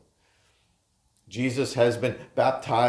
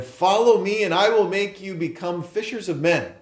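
calling out; 7 semitones higher, 3 dB louder than usual. Acted. Jesus calls fishermen in reading.

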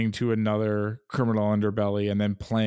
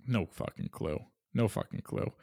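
muttered under breath; 2 semitones lower, 9 dB quieter than usual. Real, not acted. Agreeing, muttered repeat.

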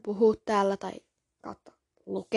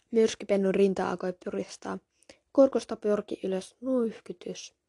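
usual speaking voice

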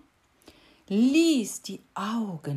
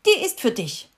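loud, higher furious shout; angry call; 6 semitones higher, 10 dB louder, faster than usual. A narrator's reading. Cricket shouting at the beetle as she slaps him. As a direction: slap the words out hard. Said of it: "rief sie".

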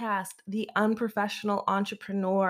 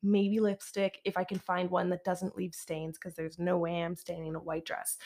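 ordinary delivery

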